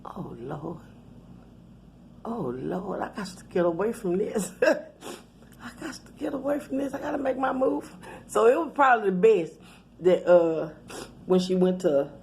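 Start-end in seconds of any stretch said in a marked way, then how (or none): 0.0-7.9 hushed spooked. Real, not acted. Spooked, wants to get away.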